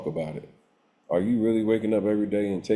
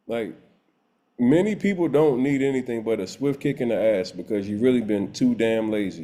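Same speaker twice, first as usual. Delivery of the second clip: usual speaking voice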